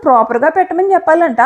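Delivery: usual speaking voice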